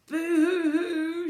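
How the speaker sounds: high, slow, loud hammed-up sobbing; mock boo-hoo crying. Girl running from the shop, hammed-up loud boo-hoo crying. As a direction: wail it like a little girl. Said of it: "she cried".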